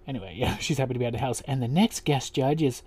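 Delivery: usual speaking voice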